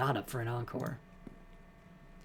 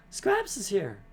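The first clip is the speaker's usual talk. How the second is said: excited gasp; 8 semitones higher, 6 dB louder, slower than usual. Real, not acted. Player gasps, recognizing the dog.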